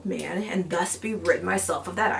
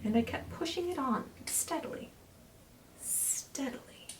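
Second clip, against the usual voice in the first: much softer, dying to a voiceless whisper; fading whisper; 4 semitones higher, 10 dB quieter than usual. Acted. Narrator creeping into the room, fading to a whisper. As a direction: let it fade to nothing.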